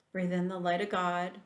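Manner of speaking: ordinary delivery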